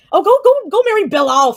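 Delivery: high, loud exasperated outburst; loud, high-pitched telling-off; exasperated yell. Real, not acted. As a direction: yell it at hecklers, fed up. Snapping back at viewers.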